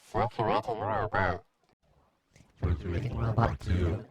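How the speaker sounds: robot voice. Shown as a demonstration. Testing phrase through robot effect.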